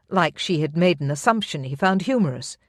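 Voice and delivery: usual speaking voice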